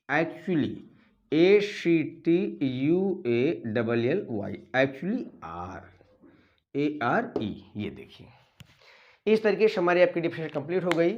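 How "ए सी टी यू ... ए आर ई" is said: letters spelled out slowly; slow spelling. Shown as a demonstration. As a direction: spell it slowly for note-taking. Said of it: "spelling while dictating".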